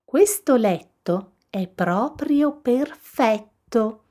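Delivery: slow, high, pleased Goldilocks; happy. A narrator's reading. Goldilocks lying in the little bed, happy. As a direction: draw it out high with contentment. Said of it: "disse felice".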